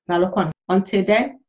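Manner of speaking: normal talking voice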